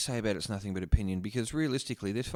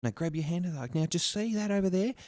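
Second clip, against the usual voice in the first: patronising mock voice; 7 semitones higher, 4 dB louder than usual. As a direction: talk to him like a small child. Mocking patronising instructions.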